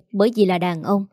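plain reading voice

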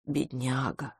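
low, very soft pitying mutter; dropped-voice murmur of sympathy. A narrator's reading. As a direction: mumble it under the breath. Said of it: "пробормотал мистер Браун".

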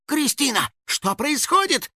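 high, drawn-out baffled question; bewildered. A narrator's reading. House spirit asking his trapped friend, bewildered. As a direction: ask high, utterly puzzled. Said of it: "недоумевал Финик".

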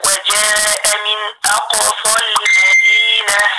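slow measured recitation of وجاء من أقصى المدينة; madd dropped in joining. Shown as a demonstration. Teacher giving a recitation example, slow and linked. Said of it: "زي ما أقول مثلًا إيه".

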